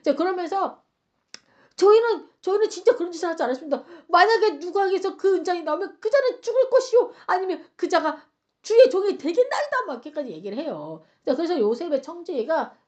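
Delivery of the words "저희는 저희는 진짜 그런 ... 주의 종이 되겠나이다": high, urgent pleading oath; acted desperate protest; swearing innocence. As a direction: insist loudly, rushing the first denial. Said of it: "막 결백을 맹세해요".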